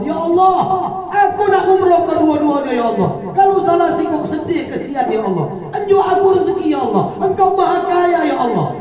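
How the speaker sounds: high, loud pleading prayer. Acted. Mother praying to Allah for her children, high and pleading. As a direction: beg God as if near tears. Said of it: "Ngomong sama Allah".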